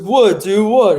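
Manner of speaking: put-on high, loud drunk protest; high, loud mock confusion; drunk buddy. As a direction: play the confused drunk friend. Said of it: "he's like".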